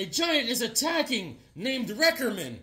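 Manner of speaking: put-on lilting old-time scoop delivery; reporter. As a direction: report the attack with hammed-up urgency. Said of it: "He's like a, a reporter".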